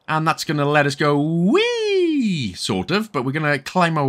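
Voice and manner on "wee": long, high whee; playful wee. Pretend. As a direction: draw out a mock swinging cry. Mimics swinging on the rope.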